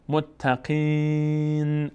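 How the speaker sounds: متقین with long drawn-out ī, ending on a vowelless n; stopping on silent nun. Shown as a demonstration. Teacher showing a verse ending, drawn-out pausal reading. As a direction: recite متقین very slowly, closing on a bare nun.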